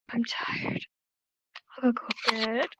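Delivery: soft, drained near-whisper; tired. Acted. Teacher acting out tired, ready for bed, drained. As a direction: act worn out, voice sinking away. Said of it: "close your eyes or lean forward".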